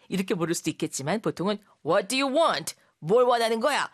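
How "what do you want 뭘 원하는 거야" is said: louder, higher confrontational question; blunt demand. Shown as a demonstration. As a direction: press for an answer, unsmiling. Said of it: "이렇게 많이 묻죠".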